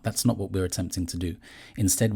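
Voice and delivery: ordinary delivery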